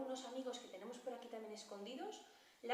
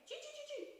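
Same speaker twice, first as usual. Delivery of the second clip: squirrel chatter; 3 dB quieter than usual. Pretend. Squirrel squeaks.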